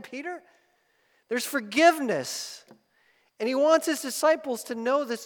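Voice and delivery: loud, high, quickening sermon climax; full-force pulpit appeal; passionate preaching. Acted. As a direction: plead with rising urgency.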